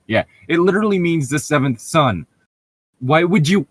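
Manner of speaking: exasperated. Real, not acted. Co-host baffled by the author's choices, exasperated. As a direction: throw up your hands in disbelief. Frustrated complaint about the author.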